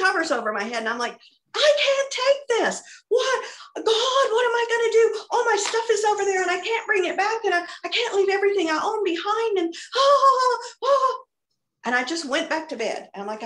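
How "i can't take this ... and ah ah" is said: high, loud wailing panic, acted out; hammed-up meltdown. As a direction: wail it like an overwhelmed tantrum, ending in cries. Acted meltdown under the covers.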